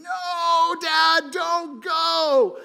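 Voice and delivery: put-on high, loud little boy's scream; screaming child. Three-year-old screams at his leaving dad, high shrieking wail. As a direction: shriek it like a toddler making a scene. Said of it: "like, screaming".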